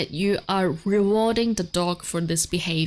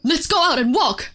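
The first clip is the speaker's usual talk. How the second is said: loud, high-pitched telling-off; mock scolding; 9 semitones higher, 6 dB louder than usual. Shown as a demonstration. Showing that a dog heeds tone, loud mock scolding. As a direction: make a walk invitation sound like a threat. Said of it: "even though you're saying".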